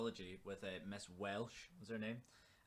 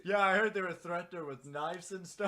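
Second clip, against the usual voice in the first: excited; 9 semitones higher, 10 dB louder than usual. Real, not acted. Gossiping excitedly with friends.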